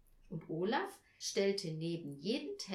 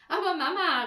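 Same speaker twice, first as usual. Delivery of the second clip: put-on loud, high child's exclamation; amused call; 9 semitones higher, 12 dB louder than usual. A narrator's reading. Olaf to his mother on seeing her hair, amused call. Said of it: "rief Olaf und lachte".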